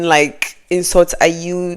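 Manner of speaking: ordinary talk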